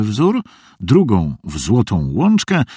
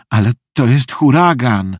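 a mild anxious moan, pitched up; 4 semitones higher, 5 dB louder than usual. A narrator's reading. Meg frightened by the storm at home, moaning. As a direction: groan it out, higher and louder. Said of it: "jęknęła Meg".

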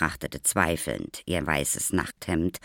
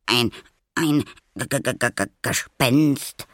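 higher, stuttering on the first sound; horrified stammer; 8 semitones higher, 5 dB louder than usual. A narrator's reading. Little boy facing a supposed ghost, horrified stammer. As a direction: stammer it out in horror, pitched up. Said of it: "stammelte er entsetzt".

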